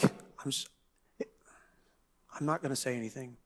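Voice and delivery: barely voiced, shrinking away; timid whisper. Meek listener whispers to avoid trouble. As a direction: whisper it like you'd rather not speak. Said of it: "Some of the rest of you are like".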